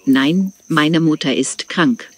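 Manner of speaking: normal talking voice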